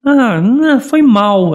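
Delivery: mock sheepish "foi mal"; sheepish child. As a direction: shrug it off like a caught teen. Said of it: "Às vezes eles falam assim".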